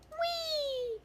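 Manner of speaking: mock glee.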